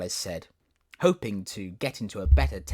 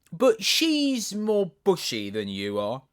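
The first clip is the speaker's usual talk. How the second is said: tiger lily voice; 9 semitones higher, 5 dB louder, slower than usual. Acted.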